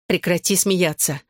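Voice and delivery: usual speaking voice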